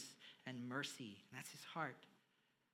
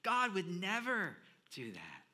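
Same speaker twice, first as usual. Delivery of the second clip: louder voiced objection; mock indignant denial; 4 semitones higher, 7 dB louder than usual. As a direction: protest as a doubter. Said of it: "maybe we're thinking".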